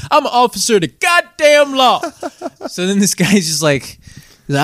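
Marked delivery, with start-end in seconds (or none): 0.1-2.0 imitating a drunk's high shout; drunk yelling cop claim. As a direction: bellow it like a drunk insisting on his authority. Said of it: "the guy who's like".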